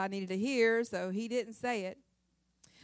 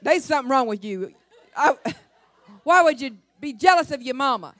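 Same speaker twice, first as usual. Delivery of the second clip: exasperated husband; 2 semitones higher, 11 dB louder than usual. Retold or imitated. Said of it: "He's like".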